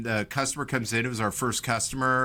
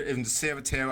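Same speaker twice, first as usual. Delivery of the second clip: usual speaking voice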